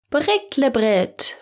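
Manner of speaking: slow drawn-out Britlebrit; magic word. A narrator's reading. Brother speaks the magic word to the donkey, slow and deliberate. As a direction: say Britlebrit slowly like a spell. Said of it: "Der Müller sagte".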